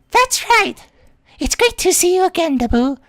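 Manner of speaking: put-on high, bubbly anime girl; sweet high-pitched schoolgirl; cute girl voice.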